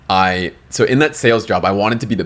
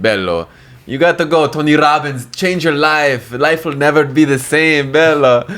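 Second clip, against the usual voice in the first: put-on high, pushy Italian accent, louder on the last "Bello"; Italian coworker impression; 8 semitones higher, 4 dB louder, slower than usual. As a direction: sell it like an excited Italian mentor. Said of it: "And he was Italian".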